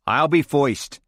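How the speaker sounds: a lightly voiced New York American accent; volunteer first in a mild New York American accent. American guest volunteering first, light New York American accent. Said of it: "said an American voice".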